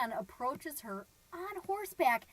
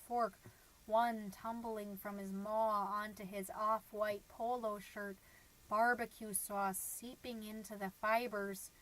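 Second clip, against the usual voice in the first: bleak brooding; 2 semitones lower, 5 dB quieter than usual. Acted. Mom dwelling on husband's dinner of cocktail weenies, bleak brooding. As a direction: sink into a soft, monotone dark reverie.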